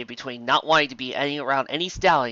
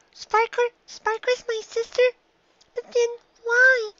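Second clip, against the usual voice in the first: high filly voice, rising and louder; a child near tears demanding why; high little filly, voice breaking; upset young filly; 4 dB louder, slower than usual. A narrator's reading.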